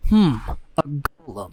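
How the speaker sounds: slow, lightly voiced dull hero; a hint of a lanky nerd drawl; bland hero voice. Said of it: "how that character sounds".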